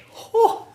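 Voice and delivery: high, loud burst of a laugh; sudden laugh. Real, not acted. Teacher breaking off the lesson after excusing themselves, sudden laugh. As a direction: let out a quick high whoop of laughter.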